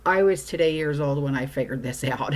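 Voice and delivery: ordinary talk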